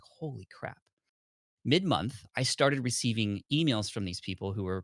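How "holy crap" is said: breathed out, much softer; stunned hush. Retold or imitated. After a second sale comes in, a stunned hushed holy crap. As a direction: let it slip out in disbelief.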